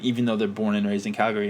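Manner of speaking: ordinary talk, nothing marked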